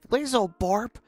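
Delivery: put-on a dad grilling his kid; scolding parent.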